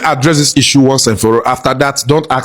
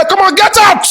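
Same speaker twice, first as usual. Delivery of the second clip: mock dismissal. Skit character dismisses candidate.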